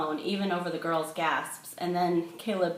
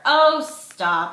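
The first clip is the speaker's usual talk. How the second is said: dismissive; 9 semitones higher, 12 dB louder than usual. A narrator's reading. Sister waves him off.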